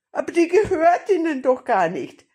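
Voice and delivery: high, loud objection; protesting grandmother. A narrator's reading.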